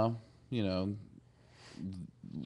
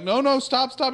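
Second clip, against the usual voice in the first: high, loud mock-panicked calling; urgent warning; 14 semitones higher, 6 dB louder than usual. Friends warn Wesley nearing the zone, urgent. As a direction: cry out to halt him, hammed up.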